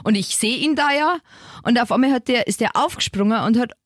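ordinary delivery

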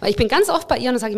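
usual speaking voice

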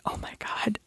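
hushed, whispered aside; whispered dismay. Retold or imitated. Newcomer recalling her reaction to the realtor's German, whispered dismay. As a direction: whisper it as a retold private reaction.

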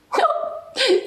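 high teasing giggle; a sly little titter; meadow giggle. Acted. Meadow laughing at the wet cat, high giggle.

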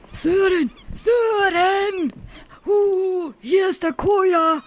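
high, drawn-out call across water. Acted. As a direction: shout his name from afar. Calling out to boat on water.

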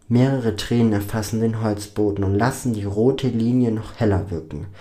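ordinary delivery

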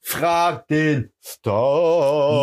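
slow, drawn-out game show welcome; show host announcer. Acted. Opens the quiz segment as host.

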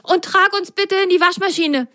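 high little asking voice; please, carry us; asking politely. Acted. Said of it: "bat Patsch". (bittende hohe Stimme)

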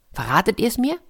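usual speaking voice

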